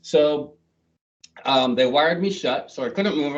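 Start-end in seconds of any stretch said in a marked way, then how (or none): none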